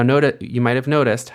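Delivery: ordinary talk, nothing marked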